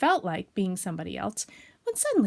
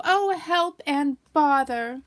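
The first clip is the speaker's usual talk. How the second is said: high, loud, drawn-out wail; long despairing storybook cry; woeful cry; 6 semitones higher, 6 dB louder, much slower than usual. Acted. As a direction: lament slowly, utterly stuck. Pooh stuck in the hole.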